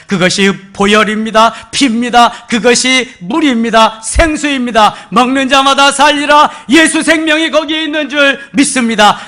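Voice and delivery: loud, high, ringing preacher's cry; fervent sermon climax; preaching at full force. Acted. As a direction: proclaim it to the whole hall.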